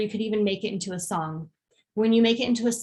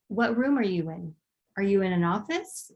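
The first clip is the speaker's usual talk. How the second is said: ordinary delivery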